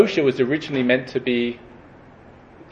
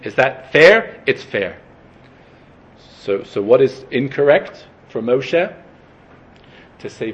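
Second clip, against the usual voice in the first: usual speaking voice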